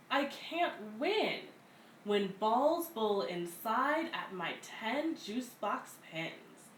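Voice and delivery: plain reading voice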